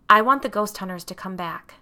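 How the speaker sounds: a touch of fright in a plea; terrified. A narrator's reading.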